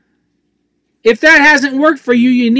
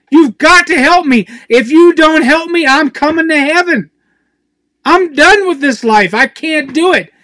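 raw prayer; 4 semitones higher than usual. Real, not acted. Preacher crying out his own prayer to God, raw and pleading. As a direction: beg God like you're at the end of your rope. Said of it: "raw with God".

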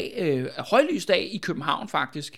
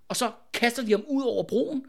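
usual speaking voice

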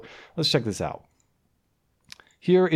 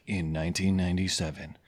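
low, softer voice of a grave scandal report; mock exposé; 8 semitones lower, 6 dB quieter than usual. Host parodies a cut-up scandal story, mock-grave. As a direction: intone it like a damning revelation.

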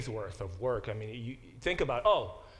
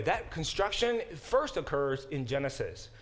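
normal talking voice

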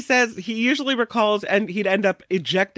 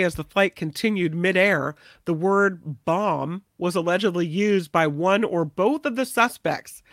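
ordinary talk, nothing marked

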